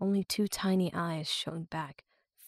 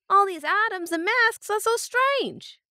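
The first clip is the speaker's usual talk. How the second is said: put-on rural accent; 13 semitones higher, 6 dB louder than usual. A narrator's reading. Other captor voiced.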